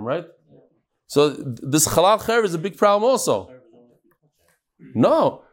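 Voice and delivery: ordinary talk, nothing marked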